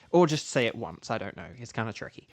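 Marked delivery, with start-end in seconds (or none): none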